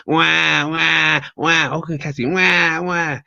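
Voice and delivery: mocking Cassie's tantrum with high wah wahs; mock crybaby wails. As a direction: wail like a bratty child throwing a fit. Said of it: "She's throwing a fit".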